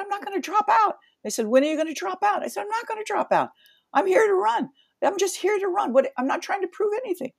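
high, defiant retort to the reporters; defiant insistence. Retold or imitated. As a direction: stand your ground, pitched high. Her defiant replies to the press.

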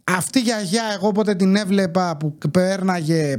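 normal talking voice

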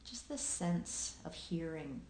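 unhurried meditation guiding with long pauses; guided meditation. Acted. Host guiding listeners into present awareness, calm meditation voice with long pauses. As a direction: lead listeners gently through their senses, leaving long silences.

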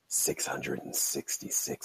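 low, slow, whispered devil voice; spooky devil voice. Pretend. Devil's number punchline.

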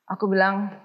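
usual speaking voice

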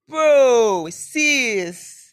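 a loud, scolding call of bro; loud exclamation. Real, not acted. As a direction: call it out in shock. Exclaiming at viewer's confession.

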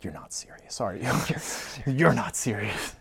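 hushed, imitated disbelief; aghast whisper. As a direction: whisper it as if stunned by the price. Wife aghast at ring's price.

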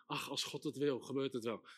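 low, soft, passive shrug of a voice; resigned passive attitude. Retold or imitated. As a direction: give up softly, sinking low. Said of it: "met de houding".

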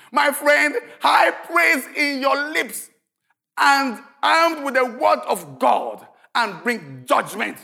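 loud, high preaching at full force; preaching shout. Acted. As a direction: thunder the promise to the congregation.

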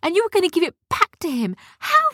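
high, rising outraged cries; outraged scolding. Acted. Sophia scolding the tricky gnomes, outraged and high. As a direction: scold them like a betrayed girl.

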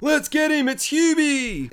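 high, drawn-out rallying call; mock mob cry. Townspeople turning on Hubie, high mock rallying cry. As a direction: raise a retold mob cry, high and stretched.

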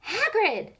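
called out high and loud; excited call. A narrator's reading. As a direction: call his name in happy surprise. Greeting called across the stands.

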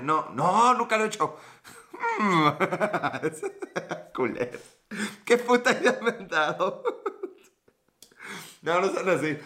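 words broken by laughter; laughing. Real, not acted. Streamer cracking up at the idea the chat gave him. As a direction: laugh through the line.

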